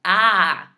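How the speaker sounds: "Ah" held long and loud, mouth wide; teacher's emphatic, drawn-out "Ah"; open vowel demo. Shown as a demonstration. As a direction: belt out a clear open "Ah" to model the vowel. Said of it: "like the letter, letter A".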